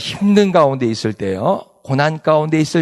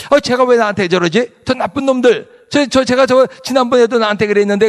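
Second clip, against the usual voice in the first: higher-pitched acted resentment; mock indignant grumbling; 7 semitones higher, 3 dB louder than usual. Brooding person complaining about an offender, mock indignant grumbling. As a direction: grumble about them, lightly hammed-up.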